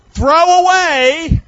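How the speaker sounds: drawn-out, high, loud call; loud proclamation. Real, not acted. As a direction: proclaim the title, stretching each word high and loud. Preacher proclaims his message title.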